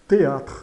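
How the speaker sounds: loud "Théâtre" with closed E. Shown as a demonstration. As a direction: say "Théâtre" with a tay opening. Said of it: "Soa E fechado".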